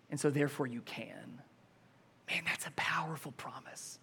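breathy whisper; whispered awe. Real, not acted. Preacher whispers in awe of God's promise. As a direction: hush the room with it.

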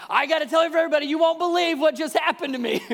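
high, loud and laughing; bursting to share the news; excited healed man. Acted. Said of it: "he does what all of us would do".

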